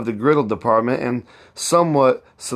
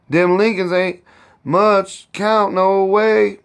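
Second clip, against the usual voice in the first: high, slow scornful dismissal; old cook scorning the neighbours, contemptuous; 8 semitones higher, 3 dB louder, slower than usual. A narrator's reading. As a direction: sniff at the Lincolns, drawing it out. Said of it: "said Aunt Chloe contemptuously".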